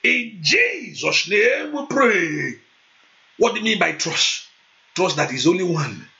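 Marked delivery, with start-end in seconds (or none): 0.0-2.6 fervent prayer. Acted. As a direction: declare it over the congregation. Fiery prayer session.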